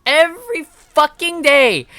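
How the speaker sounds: high, slow, drawn-out exclaiming; outraged rant. Real, not acted. Streamer protesting prison for playing a game, outraged rant. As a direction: stretch each phrase in loud disbelief.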